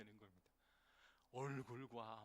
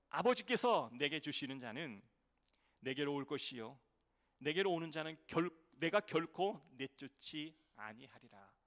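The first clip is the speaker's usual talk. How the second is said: scripture reading; 10 dB louder than usual. Acted. Pastor reading John to his congregation, loud and solemn.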